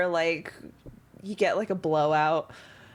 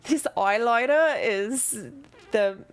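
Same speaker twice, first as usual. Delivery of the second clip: put-on Australian accent; 5 semitones higher than usual. Drow applying eyeliner on Kothar, Australian accent. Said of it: "Drows have Australian accents".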